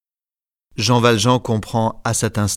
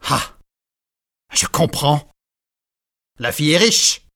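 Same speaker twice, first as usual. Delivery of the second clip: lightly voiced old man, mocking and cold; scornful grandfather; 5 semitones higher than usual. A narrator's reading. Grandfather mocks Marius's wish to marry, lightly scornful. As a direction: sneer at the young man's plans.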